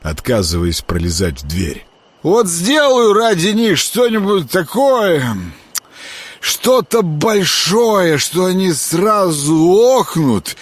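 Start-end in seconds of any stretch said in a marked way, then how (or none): 2.2-10.6 put-on slow, dragging drunken boast; drunk slurring. A narrator's reading.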